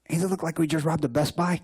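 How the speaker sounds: a light Chicano stoner accent, mimicking; retold in a mock Mexican-American accent; Cheech Marin impression. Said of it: "all us Mexicans speak like Cheech Marin".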